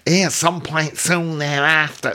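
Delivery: a high, hammed-up Mancunian accent attempt; bad Manchester accent. Shown as a demonstration. Host reading a poet's memoir, trying a Mancunian accent. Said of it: "That was awful".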